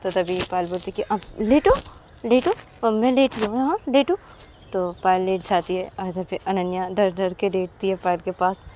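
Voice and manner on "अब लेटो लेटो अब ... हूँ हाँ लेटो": coaxing friend. Acted. Payal tells her to lie.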